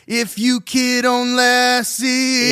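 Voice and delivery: put-on "kid" for could, sung high, slow. Shown as a demonstration. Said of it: "It's like".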